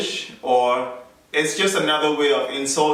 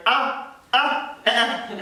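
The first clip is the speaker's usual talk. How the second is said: much higher, short "ah" and "eh" bursts; imitated Nigerian exclamations; 10 semitones higher than usual. Husband mimicking wife's Nigerian expressions, high short exclamations. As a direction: mimic her high-pitched interjections. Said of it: "You see what she's saying?".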